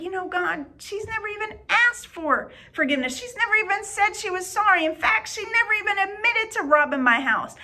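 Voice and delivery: high, pleading complaint to God; arguing with God about the thief, protesting high and loud; exasperated protest. Retold or imitated. She protests to God about forgiving, exasperated high plea. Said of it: "And I'm like".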